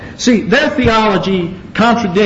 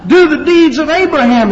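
high, raised preaching voice; forceful rebuke; 9 semitones higher, 4 dB louder than usual. Acted. Jesus challenging those claiming Abraham as father, forceful rebuke. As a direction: challenge them in a high, ringing voice.

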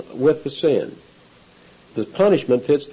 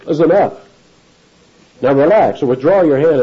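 commanding angel; 4 semitones higher, 6 dB louder than usual. Acted.

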